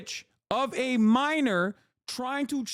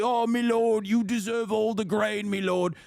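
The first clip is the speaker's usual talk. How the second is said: mock peasant voice. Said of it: "You're like".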